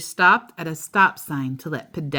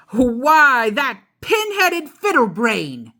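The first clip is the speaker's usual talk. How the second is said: a touch of a snarl, louder, higher; Papa Bear hammed up, mildly snarling at the driver ahead; 7 semitones higher, 5 dB louder, slower than usual. Mock snarl at the car in front. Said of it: "he snarled".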